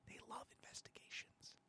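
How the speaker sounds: sudden hushed whisper; whispered aside. Real, not acted. Host adds an aside about Republicans and investigations, whispered. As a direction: drop from ranting to a tiny whisper.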